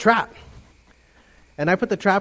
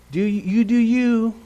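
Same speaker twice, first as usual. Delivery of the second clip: mock slow, higher worldly voice; worldly attitude; 4 semitones higher than usual. The culture telling you what feels good, mock worldly attitude. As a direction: lightly put on a permissive attitude. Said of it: "with that kind of attitude".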